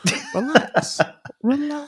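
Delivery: high, soft relax relax; patronizing calm-down; mock soothing. Friend teasing him to relax, high and soft. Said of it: "teasing relax".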